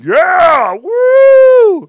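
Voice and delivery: high, loud hammed-up whoop; mock cheering. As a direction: whoop like a crowd of excited guys. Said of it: "they're all like".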